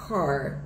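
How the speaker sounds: car with a curled R; American English pronunciation. Shown as a demonstration. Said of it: "em inglês americano".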